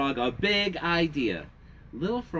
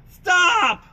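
high, loud shouted stop; Little Frog shouting stop at the babies; 9 dB louder than usual. Acted. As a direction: yell it to silence the babies. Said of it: "shouted Little Frog".

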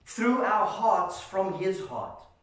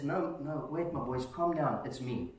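low, soft, soothing voice; calming reassurance; 7 semitones lower, 8 dB quieter than usual. Acted. Jesus calming his frightened disciples, low soft reassurance. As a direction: settle frightened friends gently, voice dropped. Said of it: "Jesus cries out and says".

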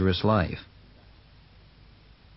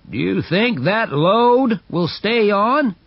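higher doubting stranger's voice; voiced onlooker; 9 semitones higher than usual. A narrator's reading. Another man doubting the load, sceptical. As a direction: sceptical question. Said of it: "asked another man".

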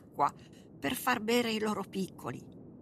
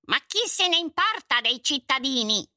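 higher, loud dismissive king; callous king interrupting; 7 semitones higher, 6 dB louder than usual. Acted. King interrupting the servant, callous. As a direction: cut him off like a heartless ruler. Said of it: "lo interruppe il re".

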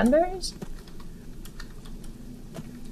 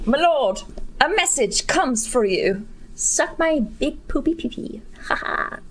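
ordinary talk, nothing marked

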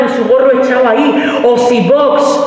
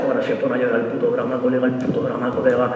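much lower, softer grumble; muttered aside; 8 semitones lower, 9 dB quieter than usual. Acted. Comic as the boyfriend grumbling about the drama, low and soft. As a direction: grumble it under your breath.

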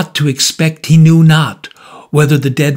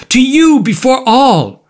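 high, emphatic cry; impassioned retort; 10 semitones higher, 4 dB louder than usual. A narrator's reading. Murderer answering the visitant, impassioned retort. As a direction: answer with fervour, pitch leaping up.

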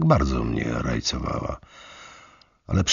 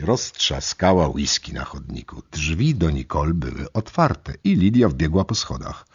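ordinary delivery, nothing marked

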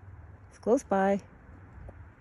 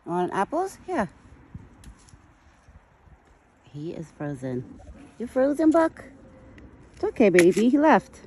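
high, sweet voice calming a scared deer; soothing pet talk; 6 semitones higher than usual. Acted. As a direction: coo to the frightened deer. Coaxing the deer with apples.

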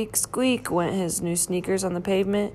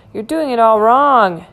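louder, insistent criticism; exasperated correction; 3 semitones higher, 7 dB louder than usual. A narrator's reading. Bert watches Ernie fumble the chain, exasperated. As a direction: break in to correct a friend, loudly.

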